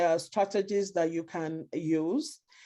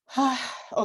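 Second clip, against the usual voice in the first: audible sigh out; sighing exhale; weary sigh; 5 semitones higher than usual. Acted. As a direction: let out a breath to reset. Acting a parent calming down.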